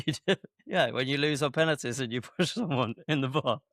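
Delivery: telling it through real giggles; words broken up by laughter; laughing while talking. Real, not acted. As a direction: crack up as you tease a friend. Teasing friend about bar incident.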